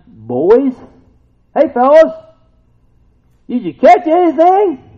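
cheery call. Retold or imitated. Jesus calling to the fishermen from shore, cheery call. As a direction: hail the boat like old buddies. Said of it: "So Jesus said to them".

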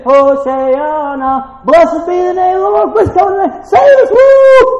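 high, loud, jubilant crowd singing-cry; excited crowd. Retold or imitated. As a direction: cheer it out like people waving palms. They get all excited.

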